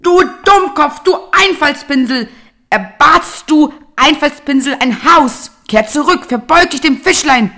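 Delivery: high, loud shrill-voiced old woman yelling; old wife screaming at her husband; scolding shout. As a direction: rage at the foolish husband, full volume. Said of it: "beschimpft sie den Mann".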